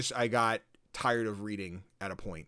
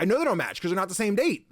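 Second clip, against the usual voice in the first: exasperated retort; 8 semitones higher, 5 dB louder than usual. Retold or imitated. Arguing with the game.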